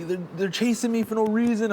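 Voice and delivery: high mock protest; imitated tearful plea. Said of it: "like, tears right now".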